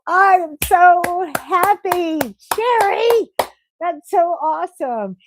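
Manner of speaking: high, slow, thrilled cheer; excited. Real, not acted. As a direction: gush with joy for her news. Cheering a viewer who reached goal.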